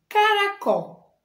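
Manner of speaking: "Caracol" said high and slow; announced title word. Shown as a demonstration. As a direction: announce the story title, drawn out. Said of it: "a gente fala caracol". (título anunciado, agudo e lento)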